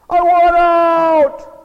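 loud, high, drawn-out cry to God; hammed-up desperate shout; desperate yell. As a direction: bellow it slowly with each word stretched. Acting out a desperate prayer.

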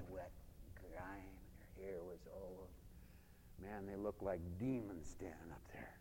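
very low, near-whispered narration; hushed storytelling. Acted. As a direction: drop to a spent hush. Tells of exhausted, hoarse worshippers.

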